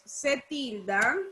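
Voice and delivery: slow, drawn-out dictation; dictating. Real, not acted. Teacher dictates the accent rule to pupils, slow dictation. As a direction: stretch each word for note-takers.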